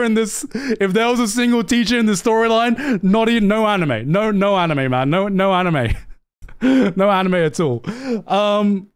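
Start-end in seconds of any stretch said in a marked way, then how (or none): none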